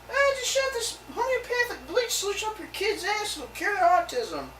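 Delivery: high, mocking salesman voice; mock quack pitch. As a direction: parody the huckster selling bleach cures.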